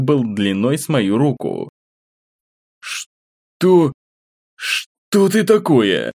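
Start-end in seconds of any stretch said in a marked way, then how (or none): 2.8-6.1 high, slow startled question; shocked. A narrator's reading. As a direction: gasp it out in shock. Hero faces monster, shocked.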